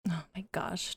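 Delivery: breathy whispered oh my gosh; whispered surprise. Real, not acted. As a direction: whisper it in disbelief. Surprised at daughter's age.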